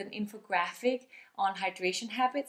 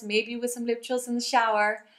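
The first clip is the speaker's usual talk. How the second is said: ordinary delivery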